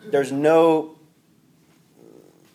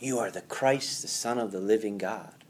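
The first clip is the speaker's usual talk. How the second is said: usual speaking voice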